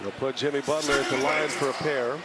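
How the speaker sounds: ordinary talk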